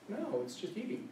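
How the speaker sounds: ordinary talk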